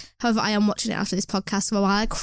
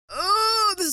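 startled yelp; 10 semitones higher, slower than usual. Real, not acted. Spots a spider mid-sentence.

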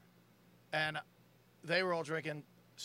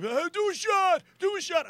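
pushy friend; 12 semitones higher, 8 dB louder than usual. Retold or imitated.

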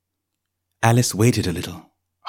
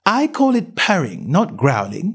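put-on young girl voice; 6 semitones higher than usual. A narrator's reading. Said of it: "said Alice".

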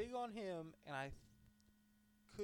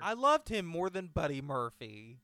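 loud, emphatic declaration; loud protest; 3 semitones higher, 8 dB louder than usual. Real, not acted. Fan defending a wrestler to co-host, loud protest. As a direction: cut in loudly to defend a favourite.

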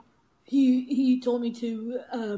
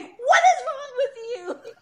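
high, loud retold yell; imitated yelling; 13 semitones higher, 6 dB louder than usual. Boyfriend at her hospital bed, imitated yelling. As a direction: mimic an exasperated yell, pitched way up. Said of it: "He was yelling at me".

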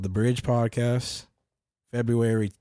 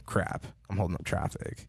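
whispering under breath; hushed, worried inner thought; whispered thought; 2 semitones lower, 8 dB quieter than usual. Retold or imitated.

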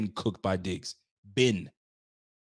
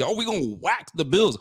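mock trash talk; 6 semitones higher, 4 dB louder than usual. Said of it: "Now they're talking greasy".

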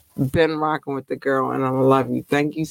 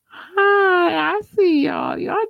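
much higher squeal of a hello; high excited greeting; slower than usual. Acted. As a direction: squeal hi at the fans.

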